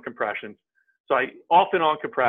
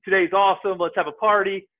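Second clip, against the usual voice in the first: imitating a carefree guy, higher pitched; happy-go-lucky; 7 semitones higher, 4 dB louder than usual. [name] imitating Justin arriving for work, happy-go-lucky. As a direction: play the upbeat newcomer ready to party. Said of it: "happy-go-lucky".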